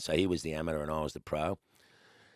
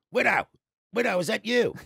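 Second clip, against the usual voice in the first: much higher, louder calling down the phone; caller's voice; 8 dB louder than usual. Retold or imitated. Mate rings from Gloucester asking for the lodger, high loud call. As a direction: call out the nickname through a phone line. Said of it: "This voice goes".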